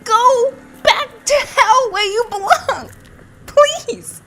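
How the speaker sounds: high, loud, strained pleading at enemies; frantic high-pitched outburst; exasperated yelling. Real, not acted. Player yelling at monsters that keep popping up, exasperated.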